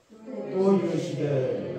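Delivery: slow, loud group read. Real, not acted. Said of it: "큰 소리로 다시 한번 시작".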